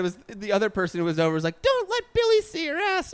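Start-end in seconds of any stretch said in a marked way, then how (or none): 1.6-3.1 very high mocking imitation; mean impression. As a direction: badly impersonate someone in a squeaky-high voice. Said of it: "that's a mean impression. I'm bad at impressions".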